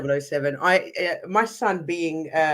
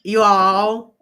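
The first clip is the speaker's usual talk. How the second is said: louder, higher stab at an American accent; attempted American accent; 4 semitones higher, 7 dB louder than usual. Shown as a demonstration. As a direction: have a go at a generic American accent. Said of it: "try your, your best generic American accent".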